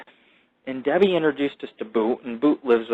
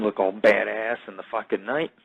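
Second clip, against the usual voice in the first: ordinary talk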